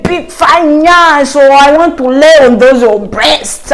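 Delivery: mock Western accent, drawn-out leering catcall; slow, thirsty come-on in a put-on Western accent; hammed-up Western-accented catcaller. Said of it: "I don't know why I'm using a Western accent".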